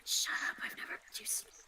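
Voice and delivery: unvoiced teasing whisper. Real, not acted. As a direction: breathe it as a secret.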